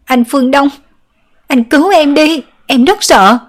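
high, loud crying plea; wailing in terror. A narrator's reading. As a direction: sob and scream for help. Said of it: "lớn tiếng gào khóc".

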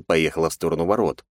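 ordinary delivery

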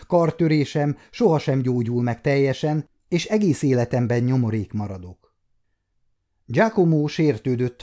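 ordinary delivery

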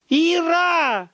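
high desperate yell of her name; frantic cry; frantic scream. A narrator's reading. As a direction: shout through the wall in panic. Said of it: "Это истошный крик из квартиры".

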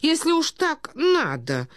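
put-on high, slow, doddering old prince; befuddled old prince. A narrator's reading. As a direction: plead feebly in a high-pitched old man's voice. (старый князь, высокий растерянный голос)

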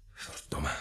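a breathy whispered oath; whispered curse. Acted. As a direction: curse under your breath. Discovering five murders. (gefluisterde vloek)

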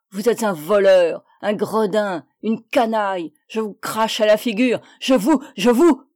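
loud enraged insults, building; furious yelling. A narrator's reading. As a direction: hurl insults until you choke on rage.

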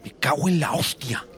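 high-pitched startled curse; loud exclamation. A narrator's reading. Antonio exclaiming as he connects the dots, loud outburst. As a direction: blurt it out as realisation hits. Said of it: "en un tono tan alto".

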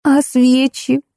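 high, mildly angry demand; cross. A narrator's reading. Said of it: "продолжала сердиться Ольга". (сердито, высоким голосом)

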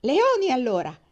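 loud, high shouted comeback. A narrator's reading. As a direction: shout it back like an impatient boy. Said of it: "gridò Jem".

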